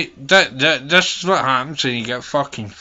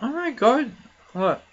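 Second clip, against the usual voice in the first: much higher, sudden shock; shocked gasp; 11 semitones higher than usual. Real, not acted. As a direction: react as if you can't believe it. Shocked at her phone screen.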